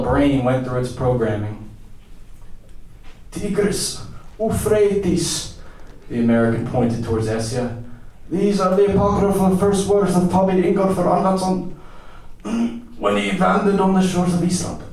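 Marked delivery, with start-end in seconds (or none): 3.3-5.6 high, declaiming madman; raving grand pronouncement; dazed American eccentric. Acted. Voices the disoriented American. 8.3-14.8 high, grandly declaiming madman; lofty, high-pitched delirium; deranged American eccentric. Acted.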